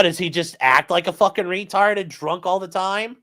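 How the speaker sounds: ordinary talk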